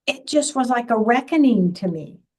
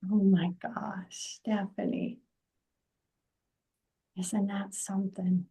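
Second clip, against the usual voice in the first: hushed, breathless whisper, much softer; awed whisper; 3 semitones lower, 10 dB quieter than usual. Real, not acted. Host, stunned by the daughter story, whispers in awe. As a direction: let the wonder take your voice away.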